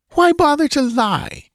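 very high-pitched, scornful woman; bitter retort. A narrator's reading. Dancer catches the priest lying, bitter retort. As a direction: throw the lie back bitterly. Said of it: "she retorted bitterly".

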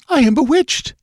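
high, alarmed queen's gasp. A narrator's reading. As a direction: cry it out high in fright. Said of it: "gasped Taramis".

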